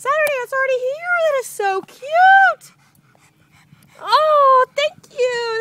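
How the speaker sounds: high, loud, gushing delight; thrilled squeal. Real, not acted. Mom thrilled the package arrived, high happy squeal. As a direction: let the joy burst out, voice way up.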